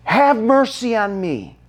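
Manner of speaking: high, pleading mock cry; imitated crying out. Blind man begging Jesus for mercy, mild imitated scream.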